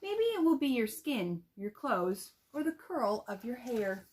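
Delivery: gentle, expressive picture-book reading; read-aloud to children. Shown as a demonstration. Teacher demo-reads a picture book.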